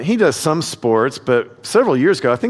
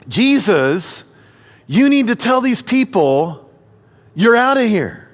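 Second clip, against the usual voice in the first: indignant Pharisees; 6 semitones higher, slower than usual. Retold or imitated. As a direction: insist they be thrown out. Pharisees demanding exclusion.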